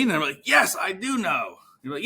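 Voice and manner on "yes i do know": much higher, eager yes; pitched-up, heartfelt mock agreement; imitated emphatic agreement. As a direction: agree as if finally understood.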